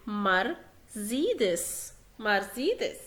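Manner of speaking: مرسيدس as "mar-ZEE-des"; slow مرسيدس with a buzzing Z in the middle. Shown as a demonstration. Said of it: "يلفظ زين".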